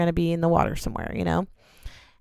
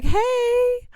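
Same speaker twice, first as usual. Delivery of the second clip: high, loud, drawn-out yell; calling out; 5 dB louder than usual. Retold or imitated. Injured woman yelling out to her hiking partner, calling out. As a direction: holler for attention from afar. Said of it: "and I yell out".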